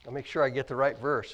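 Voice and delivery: ordinary delivery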